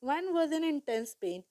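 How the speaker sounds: usual speaking voice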